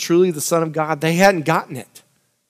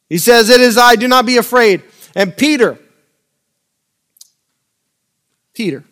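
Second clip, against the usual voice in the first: louder preaching voice, driving the scripture; 4 semitones higher, 3 dB louder than usual. Acted. Preacher reading the storm scripture to the church, in full preaching voice. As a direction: preach it to the congregation.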